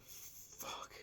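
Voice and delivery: breathless whispered Fuck of delight; whispered curse. Retold or imitated. Tasting the burger, a whispered swear of delight. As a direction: mouth it, overwhelmed by the taste. First bite of egg burger.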